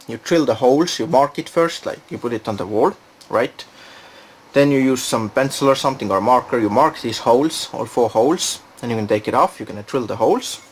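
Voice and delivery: usual speaking voice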